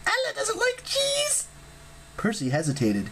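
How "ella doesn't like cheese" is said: very high, slow bird-woman voice; a skittish harpy stating a fact; high jittery harpy chatter. A narrator's reading. Ella the harpy speaks.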